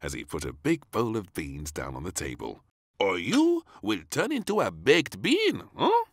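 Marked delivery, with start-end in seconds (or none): 3.0-6.0 high, loud warning; warning chef. Acted. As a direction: caution the child playfully.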